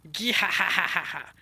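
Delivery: drawn-out, loud evil cackle; slow gloating villain laugh. Acted.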